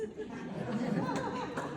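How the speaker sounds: a high burst of laughter; a sudden high delighted laugh. Real, not acted. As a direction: squeal with delight at a friend. Teasing Ricky in the audience.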